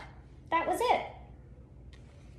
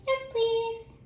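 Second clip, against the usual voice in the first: grateful reply; 12 semitones higher than usual. Acted. Bean accepting help.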